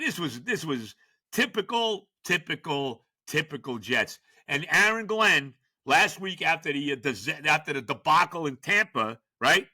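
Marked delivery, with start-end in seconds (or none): none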